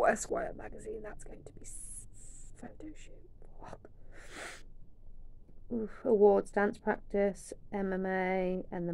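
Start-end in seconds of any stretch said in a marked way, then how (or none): 0.0-3.9 breathless hushed whisper; awed whisper. Real, not acted. Fan whispering in awe over a photo shoot. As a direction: whisper it in disbelief.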